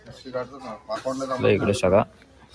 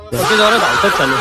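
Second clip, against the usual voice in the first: excited shout; 8 semitones higher, 9 dB louder than usual. Real, not acted. Excited at running carriers.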